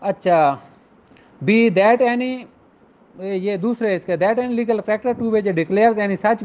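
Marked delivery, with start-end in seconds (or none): none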